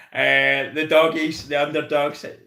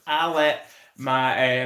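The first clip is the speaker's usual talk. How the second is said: ordinary talk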